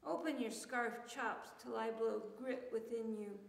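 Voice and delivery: ordinary talk, nothing marked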